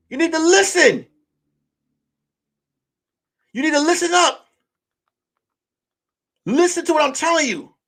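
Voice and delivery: high, insistent telling-off; exasperated, high-pitched orders; retold stern scolding. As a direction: act out losing patience in a quarrel. Acted quarrel, laying down the law.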